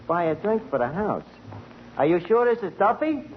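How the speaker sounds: ordinary delivery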